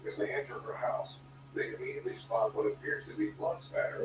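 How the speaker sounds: distant tv news from another room; tv through the wall. Real, not acted. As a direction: tv news faintly in the background. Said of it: "Can you hear the T V?".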